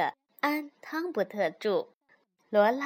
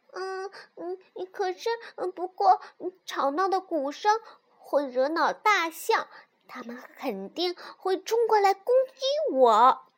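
high cub voice, hesitant then emphatic; little fox; 6 semitones higher, 4 dB louder than usual. A narrator's reading. Little fox warns mother of elephants, high fox voice. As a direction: start with hesitant hums, build up high and louder. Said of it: "小狐狸又说".

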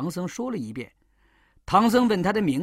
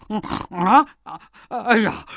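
louder startled gasp; 3 semitones higher, 6 dB louder than usual. Acted. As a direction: jolt at the name. Pig hears Monkey King's name.

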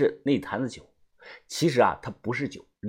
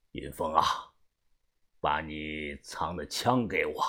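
quiet order; 9 semitones lower, 9 dB quieter than usual. A narrator's reading. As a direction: order it privately without raising your voice. Boss privately demands hidden gun. (低声缓慢地命令)

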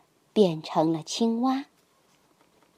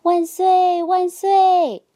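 much higher, louder victory cry; joyful cheer; 9 semitones higher, 7 dB louder, much slower than usual. A narrator's reading. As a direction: shout hooray with all your heart. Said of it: "青蛙兄弟们高兴地叫了起来".

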